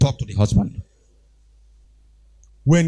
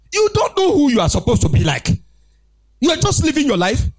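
ordinary delivery

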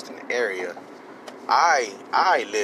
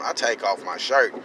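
ordinary delivery

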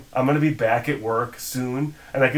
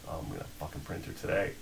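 muttered to self; 7 semitones lower, 13 dB quieter, faster than usual. Retold or imitated. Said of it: "and I'm like".

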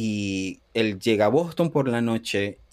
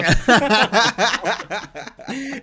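laughing; 11 semitones higher, 4 dB louder than usual. Real, not acted. Laughs at double meaning.

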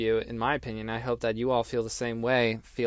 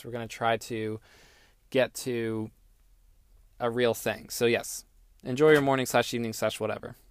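ordinary delivery

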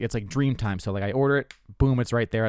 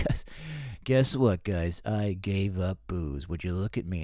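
lower, softer, slow smug boast; a quiet fake-humble show-off; smug comedian brag; 3 semitones lower, 5 dB quieter, slower than usual. Comic mocking a comedian's booze brag, low and smug.

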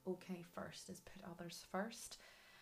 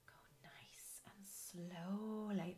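careful unhurried voice; 2 semitones higher, slower than usual. Acted. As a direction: ease through each line gently. Soothing card reading advice.

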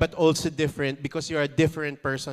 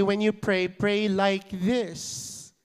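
slow, drawn-out prayer opening; slow leading prayer; 3 semitones higher, much slower than usual. Shown as a demonstration. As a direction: lead the room into prayer slowly. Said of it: "come on, everyone".

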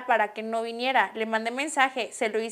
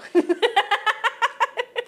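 high laugh; 9 semitones higher than usual. Real, not acted. Laughing at argument joke. (risa aguda)

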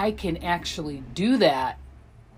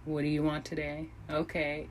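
laid-back mosey; 9 dB quieter than usual. Retold or imitated. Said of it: "mosey on over there like".

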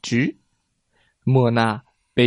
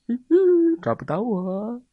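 teasing call; 10 semitones higher than usual. A narrator's reading. Hide and seek game.

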